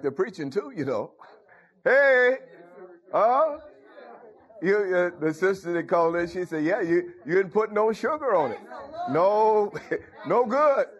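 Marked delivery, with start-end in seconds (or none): 1.8-10.9 excited outburst. Real, not acted. Preacher stirred up by the caller's remark, excited. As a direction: call out with rising excitement.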